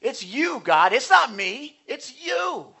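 high, whining blame, stuck on you're not; whiny accusation. Acted. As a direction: point the finger at God and whine. Pointing their fingers at God.

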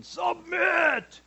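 high, strained mock shout; forceful mock command. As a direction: yell it like a wrestler forcing surrender. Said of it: "not gonna put you in a full Nelson".

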